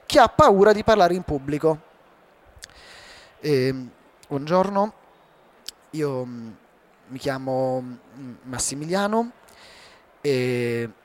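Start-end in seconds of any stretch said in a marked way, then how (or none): 3.4-10.9 much lower and softer, hesitant with fillers; timid low voice. Shown as a demonstration. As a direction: mumble like someone terrified of speaking in public. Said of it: "cioè, voce bassa, giusto?". (voce bassa e timorosa, molto più grave e piano)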